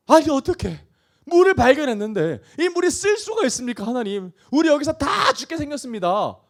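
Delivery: high-pitched, aggrieved protest; desperate complaint. Acted. Preacher as thirsty Israelites complaining to God, desperate protest. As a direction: plead and protest as if doomed.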